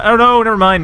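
high, loud sudden oh no; quick dismayed correction; surprised. Real, not acted. Commentator's prediction suddenly fails.

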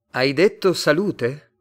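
loud, high, slow questioning; baffled question. A narrator's reading. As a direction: ask it loudly in puzzlement. Two strangers baffled, questioning each other.

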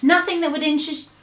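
quick evasive answer. A narrator's reading. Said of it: "the B F G answered".

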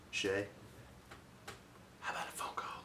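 soft flirty whisper. Real, not acted. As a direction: whisper it suggestively. Unvoiced whisper aside.

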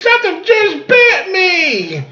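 squealing deer in mock pain; high, drawn-out comic yelp; Bambi's daddy. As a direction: shriek like a wounded cartoon animal. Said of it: "And poor Bambi's daddy went".

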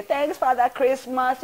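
cheery thanks. Retold or imitated. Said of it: "we say".

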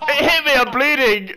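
high, loud excited cries; panicking gamer. Real, not acted. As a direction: still shaken, shouting. After the bear attack.

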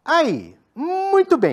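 high, drawn-out praise; praise the dog. Real, not acted. Trainer praising the dog after it obeys. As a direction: reward the dog with a bright high voice.

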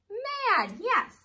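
high excited praise. Shown as a demonstration. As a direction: cheer the answer. Teacher praising blended word.

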